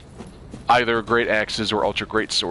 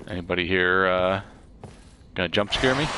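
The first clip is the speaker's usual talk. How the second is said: normal talking voice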